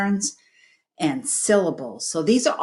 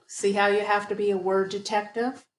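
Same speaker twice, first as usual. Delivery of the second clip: usual speaking voice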